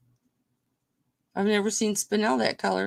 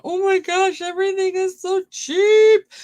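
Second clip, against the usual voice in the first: much higher, louder mock gush; imitating Olga; 9 semitones higher, 7 dB louder than usual. Seller voicing a buyer thrilled by prices, high mock gush. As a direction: squeal like a thrilled bargain hunter. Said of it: "Olga's in here going".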